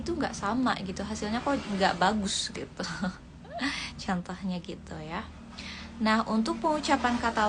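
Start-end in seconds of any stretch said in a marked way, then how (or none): 3.5-4.0 short laugh. Real, not acted. Laughs at own example.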